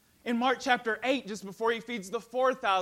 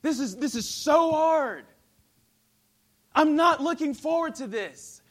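pleading, much louder and higher; anguished prayer; 6 semitones higher, 8 dB louder than usual. Acted. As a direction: beg God in anguish. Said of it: "pouring out his heart to God".

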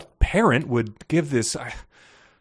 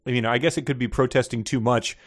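normal talking voice

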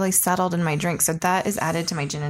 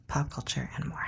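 whisper; 5 semitones lower, 9 dB quieter, faster than usual. Acted. Playful aside in intro.

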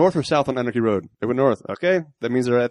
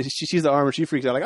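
ordinary talk

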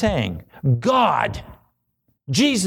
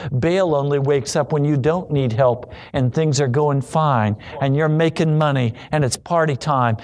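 normal talking voice

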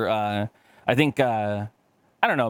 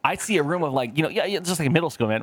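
ordinary delivery

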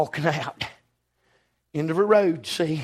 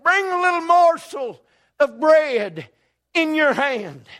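higher, slow call after her; Elijah calling out; 9 semitones higher, 3 dB louder, slower than usual. Acted. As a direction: call out to the departing widow. Elijah calls after the widow.

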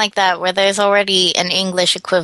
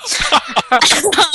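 laughing; 7 semitones higher than usual. Real, not acted. Laughing at own remark.

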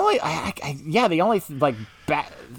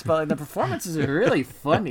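normal talking voice

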